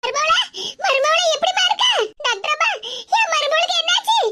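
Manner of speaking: high, pleading cries for her daughter-in-law; anxious grandmother calling out. Acted. Grandmother begging the doctor for news, panicked and high. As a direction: call out in panic, voice climbing.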